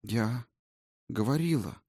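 timid, halting, barely there; faltering murmur. A narrator's reading. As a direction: stammer it out, ashamed. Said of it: "пролепетала она".

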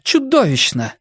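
breathless exclaim, fast breathing; hyperventilating. Acted. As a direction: gasp it out between breaths. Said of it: "воскликнула Вера, часто дыша".

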